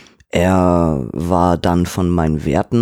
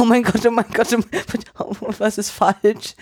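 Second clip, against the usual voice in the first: much higher, hammed-up panicky 'oh mein Gott'; mock panic; 12 semitones higher than usual. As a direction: squeak it out in comic alarm. Panicked inner thought, retold.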